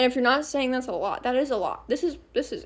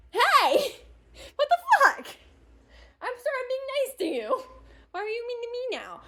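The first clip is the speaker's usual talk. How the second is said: mock indignant protest; 11 semitones higher than usual. Protesting to coworker who started being mean back, mock indignant. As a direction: imitate a wounded, squeaky complaint.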